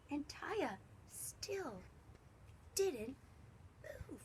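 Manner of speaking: slow and soft, fading away; tiptoeing storyteller hush; hushed suspense. A narrator's reading. Suspense as Taya holds still.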